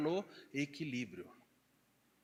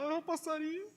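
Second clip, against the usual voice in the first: mock weepy; 11 semitones higher than usual. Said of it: "começa a chorar".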